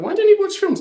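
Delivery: high, loud mock question; mocking people. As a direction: ask it like a nagging friend. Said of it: "But people are like".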